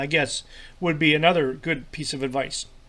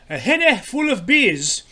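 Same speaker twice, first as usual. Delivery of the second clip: higher, slow, poor attempt at Scottish; bad Scottish accent; 6 semitones higher, 4 dB louder than usual. Host quoting a Scottish translation, bad Scottish accent. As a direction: have a clumsy go at a Scottish accent. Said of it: "that's not a very good Scottish accent".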